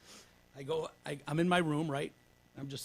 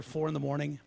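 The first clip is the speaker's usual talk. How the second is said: ordinary delivery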